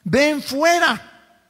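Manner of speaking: a huge, high, slow-drawn cry calling the dead man out; commanding shout. Acted. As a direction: shout it out as a command across the tomb, stretching every word. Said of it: "Y le gritó a Lázaro y le dijo".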